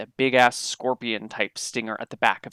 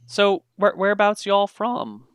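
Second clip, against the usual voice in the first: lightly voiced folksy local, higher pitch; curious local; 9 semitones higher than usual. Local asks visitors where they're from, curious and folksy. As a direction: ask as a curious townsperson. Game master plays a local.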